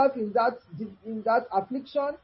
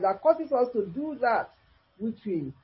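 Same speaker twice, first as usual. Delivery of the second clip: ordinary talk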